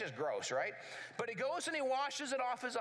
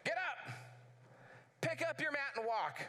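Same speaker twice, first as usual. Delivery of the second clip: commanding call; 5 semitones higher than usual. Acted. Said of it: "he yelled it".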